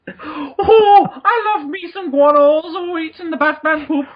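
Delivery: mock Irish leprechaun voice; Irish accent. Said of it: "devolved into an Irish accent".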